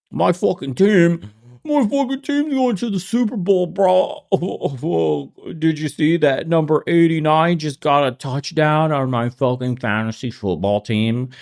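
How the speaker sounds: high, excited bro voice mocking a fan; mock football fan. Mocking a fantasy football fan, high bro voice. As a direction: ham up a dumb fan bragging.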